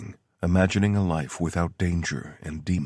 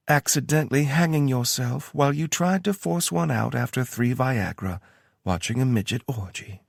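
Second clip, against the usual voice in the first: mock pity; 8 semitones higher than usual. A narrator's reading. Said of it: "Tara said with mock pity".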